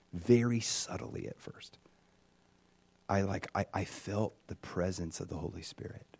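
hushed, almost whispered; soft awed near-whisper. Real, not acted. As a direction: draw them in with a breathless hush. Awe at first feeling God.

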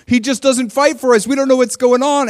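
high, loud mock whine; whining. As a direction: whine like a self-pitying victim. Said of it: "they're just, like, whining against God".

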